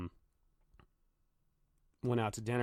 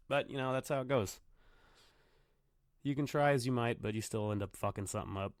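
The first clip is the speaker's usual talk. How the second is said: usual speaking voice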